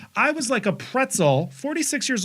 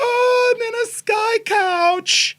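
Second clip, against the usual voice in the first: thrilled; 5 dB louder, slower than usual. Retold or imitated. Thrilled at sky couch.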